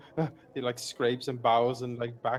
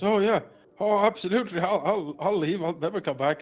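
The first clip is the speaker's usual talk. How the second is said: loud, higher, eager babble; frightened agreement; 3 semitones higher, 7 dB louder than usual. Acted. Captive bandit promising to leave and never come back, frightened and eager. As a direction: agree in a hurry, tripping over the first words.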